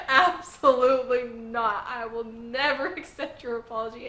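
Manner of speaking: loud burst on the first words, then easing; mock yell, breaking into a laugh; imitated yell. Boy rejecting the girl's apology, mock yelling. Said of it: "he just yells at Anya".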